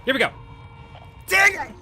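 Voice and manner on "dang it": sharp high yelp of annoyance; frustrated yelp. Real, not acted. Player yelping at a game setback.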